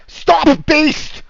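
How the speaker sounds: high, very loud shout of alarm; commanding shout. Acted. Paladin on the wall halting approaching strangers, shouting. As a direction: bellow an order to halt.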